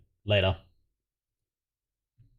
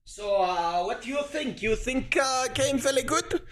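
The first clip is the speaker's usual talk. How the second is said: high, thin put-on Chinese accent in broken English; mock Chinese accent; 12 semitones higher, 6 dB quieter than usual.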